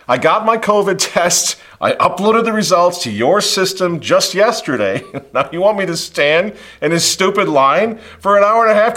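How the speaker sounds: exasperated demand at the gate agent; impatient. Retold or imitated. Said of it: "I got a little impatient".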